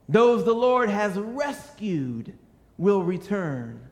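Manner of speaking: loud, slow proclaiming; full-force preaching. Acted. Pastor proclaiming God's promise to the congregation, loud and slow. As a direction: declare it like a promise from the pulpit.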